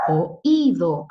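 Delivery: "Oído" with a break between O and a loud Í; split into syllables. Shown as a demonstration. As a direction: punch the separate í in "Oído". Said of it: "ahí hay sílabas distintas".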